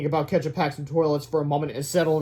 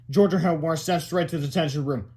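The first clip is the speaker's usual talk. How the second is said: ordinary delivery, nothing marked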